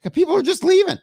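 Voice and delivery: high-pitched dismay; dismayed outburst. Real, not acted. Streamer watching viewers leave, dismayed. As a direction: cry out as the audience walks off.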